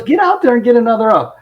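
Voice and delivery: bossy order. Retold or imitated. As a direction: send a slacker back to work. Boss orders him back to selling.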